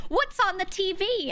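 high, drawn-out mock-curious question; slow, high, overdone kid curiosity; theatrical kid. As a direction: imitate a toddler slowly hamming up innocence. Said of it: "He said it just like that".

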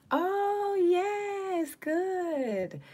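high squealing oh yes good; excited squeal of approval; delighted squeal. Real, not acted. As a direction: shriek with delight at the news. Delighted at fresh sea moss.

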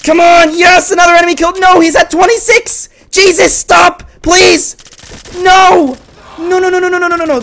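very high, loud gamer yelling; all-out worked-up outburst; frantic shouting. Real, not acted. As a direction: shriek with excitement and dismay. Frantic yelling during close match.

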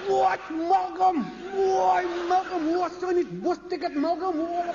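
a passer-by spotting his football idol, excited yelling; starstruck fan. Retold or imitated. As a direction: cry out in disbelief at a local hero. Passers-by recognising Malcolm.

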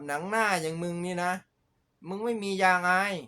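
loud, high-pitched telling-off; scornful scolding. Real, not acted. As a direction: scold them loudly, voice pushed up. Scolding the people he is ranting about. (ด่าประจานเสียงดังและสูง)

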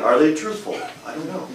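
normal talking voice

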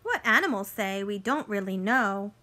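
bright storybook read-aloud for little ones; picture book voice for kids; reading to children. Acted. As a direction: read it like story time on the rug.

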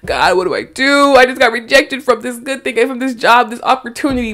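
hammed-up, high-pitched lament; exasperated mock prayer, rising high; complaining to God. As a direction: whine to God as a mock rejected job seeker. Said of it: "In our minds, we're like".

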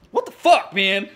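high, loud burst of disbelief; shocked outburst. Retold or imitated. Recalling the shark movie, an incredulous shout. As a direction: blurt it out in retold shock.

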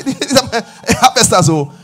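slow, laughing "Harvesters". Real, not acted. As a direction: let the name dissolve into a laugh. Laughing about church name.

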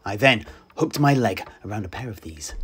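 ordinary delivery

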